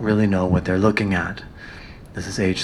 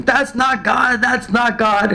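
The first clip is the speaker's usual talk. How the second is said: retold shouting; 12 semitones higher than usual. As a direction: yell it out as the child who has been tricked. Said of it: "she starts shouting".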